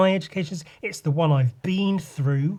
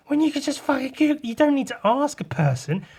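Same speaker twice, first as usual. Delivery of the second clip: exasperated; 11 semitones higher than usual. Real, not acted. Exasperated rant about customers.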